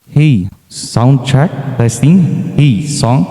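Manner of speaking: slow, echoing mic test; reverb effect. Shown as a demonstration. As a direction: sound check through heavy reverb. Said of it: "automatic na meron na siyang reverb".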